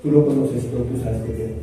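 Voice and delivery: a soft, tearful confession; tearful Peter. Retold or imitated. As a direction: Peter answering through tears, quiet and low. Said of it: "llorando y dice".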